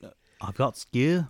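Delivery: slow mock-British child; lightly put-on British schoolgirl accent; little British girl. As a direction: copy the British girl, drawing out the end. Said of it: "I fucking love that video".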